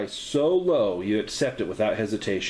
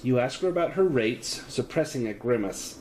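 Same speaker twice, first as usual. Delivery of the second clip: normal talking voice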